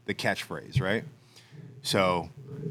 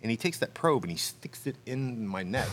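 normal talking voice

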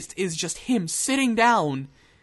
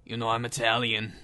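Steven Seagal; 11 semitones lower, 8 dB quieter than usual. Acted. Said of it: "in a really low, gruff voice".

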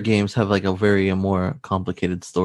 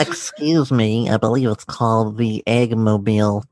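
obnoxious nerd voice. Acted. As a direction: play the pedantic fan, lightly. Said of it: "obnoxious Sonic nerd voice".

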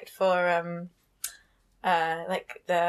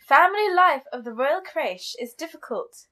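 put-on posh reading, higher and swooping; posh woman; 4 semitones higher, 4 dB louder than usual. Podcast host reading a chapter quote, mock-posh woman. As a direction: read it aloud as a posh lady. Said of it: "reading it as a posh woman".

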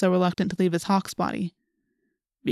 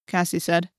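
ordinary delivery

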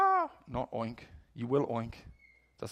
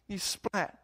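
ordinary talk, nothing marked

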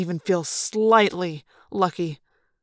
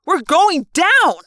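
high, loud, stretched-out shout; exasperated scream of alarm; panicked yell; 9 semitones higher, 6 dB louder, much slower than usual. A narrator's reading. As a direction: yell it slowly to hammer it home. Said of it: "Marco yelled".

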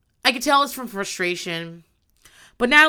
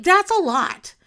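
ordinary talk, nothing marked

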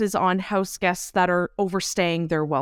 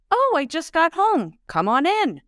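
cheerful welcome; 7 semitones higher than usual. Retold or imitated. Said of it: "she would greet them with either".

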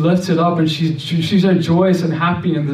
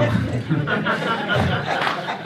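laugh; 5 semitones higher, 6 dB quieter than usual. Real, not acted. Laughs at his own boldness.

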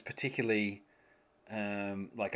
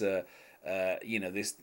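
ordinary talk

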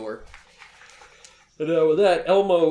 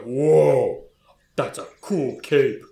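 loud, drawn-out dopey amazement; agape oaf; 7 dB louder, much slower than usual. Acted. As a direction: play a big oaf awestruck, slowly. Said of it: "he stands back agape with his eyes wide open".

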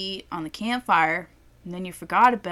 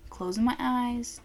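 ordinary delivery

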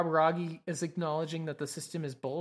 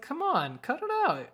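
high-pitched, playful brush-off; mock protest; 10 semitones higher than usual. Recapper plays a character telling someone to stop, high mock protest. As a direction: voice jumps up in acted objection. Voices Natsume brushing off Kaburagi.

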